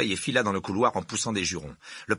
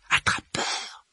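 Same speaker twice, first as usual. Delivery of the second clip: astonished exclamation; much slower than usual. Acted. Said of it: "s'exclama Ron".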